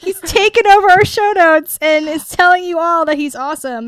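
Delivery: loud, high-pitched mock alarm; mock outrage. Host finding Hondo in her show notes, loud mock outrage. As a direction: exclaim over it in hammed-up disbelief.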